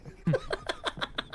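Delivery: shrill burst of laughter; high squeal of laughter. Real, not acted.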